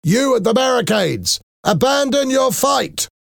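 very high-pitched commanding yell; shouted order. A narrator's reading. Soldier calls to the rebels at the barricades, shouting. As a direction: call it out as if across a street. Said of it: "A soldier shouted".

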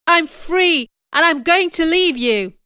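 high, loud declaration; protesting. A narrator's reading. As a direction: declare it forcefully. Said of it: "I protested".

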